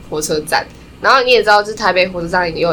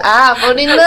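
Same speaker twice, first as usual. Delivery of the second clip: imitating the staff's realization; 4 semitones higher, 4 dB louder than usual. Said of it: "然后说".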